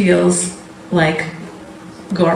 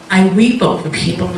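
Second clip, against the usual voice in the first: normal talking voice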